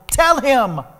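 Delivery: high, loud command. Retold or imitated. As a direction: urge him on, loud and insistent. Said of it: "the voice got louder".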